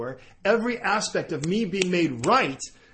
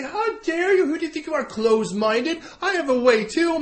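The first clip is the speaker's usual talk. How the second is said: mock indignant objector; 9 semitones higher than usual. Listener objecting to the preacher, mock indignant outrage. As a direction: play the huffy accuser.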